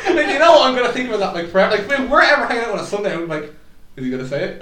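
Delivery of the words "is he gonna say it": hushed aside. Pretend. Imagined hushed aside about friend.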